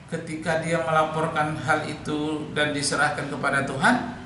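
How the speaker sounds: ordinary talk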